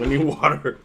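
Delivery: talking with sour candy in mouth; eating sour candy. Real, not acted. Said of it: "Oh, that's sour".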